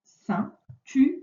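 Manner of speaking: "Ceinture" slowly, with gaps between syllables; teacher separating each syllable of "Ceinture"; split into syllables. Shown as a demonstration. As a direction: sound out "Ceinture" beat by beat. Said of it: "la première".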